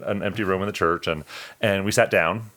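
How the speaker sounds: ordinary talk, nothing marked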